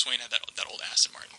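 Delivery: whispered aside. Real, not acted. Car guy adding a quiet aside about the Aston Martin, whispered. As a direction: murmur it as a side note.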